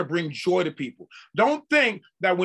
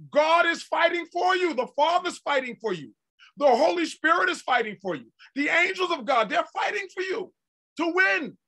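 full force preaching; 8 semitones higher than usual. Acted. Preacher to congregation, rising to a shouted climax. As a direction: cry it out over the congregation.

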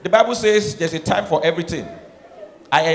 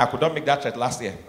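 normal talking voice

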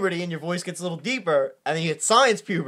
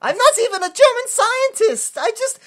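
high, hammed-up cartoon German accent; squeaky mock German scientist voice, much higher; cartoonish German accent; 14 semitones higher, 4 dB louder than usual. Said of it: "I talk like this".

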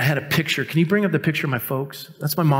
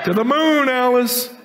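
high, loud catchphrase bluster; hammed-up empty threat; comic mock threat; 9 semitones higher, 3 dB louder, slower than usual. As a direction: bellow it up high like a sitcom husband.

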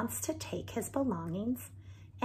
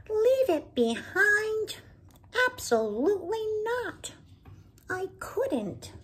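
shocked Binkle; 12 semitones higher, 6 dB louder than usual. Acted. Binkle refuses Maybelle's advice, high and shocked. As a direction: gasp at the very idea.

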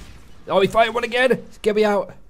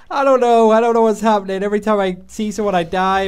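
exasperated laughing; 6 semitones higher, 5 dB louder than usual. Real, not acted. Laughing frustration after dying.

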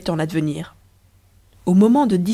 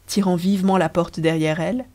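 plain reading voice

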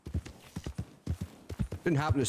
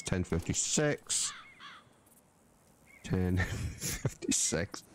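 slow repetition; 6 semitones lower, 4 dB quieter, slower than usual. Real, not acted. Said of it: "Remember that".

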